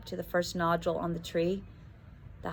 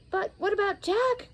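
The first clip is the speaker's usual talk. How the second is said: cried out; 13 semitones higher than usual. Acted. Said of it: "cried Tom".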